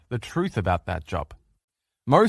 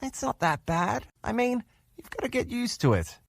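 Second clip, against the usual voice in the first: gentle reassurance; 10 semitones higher, 6 dB quieter than usual. Retold or imitated. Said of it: "every one of you would look at her and say".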